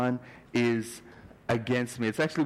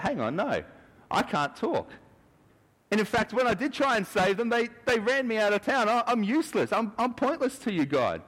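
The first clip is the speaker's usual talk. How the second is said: high, pleading self-pitying whine; whiny Moses; 11 semitones higher than usual. Retold or imitated. Moses begging God to send someone else, in a high whine. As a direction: Moses protesting to God he is useless.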